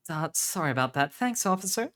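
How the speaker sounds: high-pitched put-on woman's voice; woman thanking an officer.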